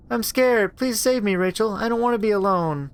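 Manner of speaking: put-on high, thin child's plea; a little sister begging her big sister; pleading little girl. Little sister begging Rachel through the door, pleading.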